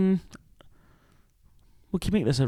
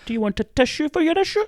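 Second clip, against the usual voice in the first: very high sing-song tease; teasing mock sympathy. Teasing co-host about his issue, mock-sympathetic high voice. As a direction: coo it in a put-on high voice.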